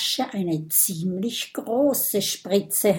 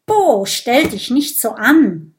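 impatient scolding; 4 semitones higher, 6 dB louder than usual. Acted. Friend scolds whining dragon.

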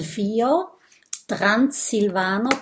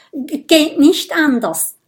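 higher, louder nervous stutter; trembling stammer; 7 semitones higher, 8 dB louder than usual. A narrator's reading. Trembling Minimo answers Bisbert before the prick, jittery. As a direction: stammer as if quivering like jelly. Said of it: "zittert wie ein Wackelpudding".

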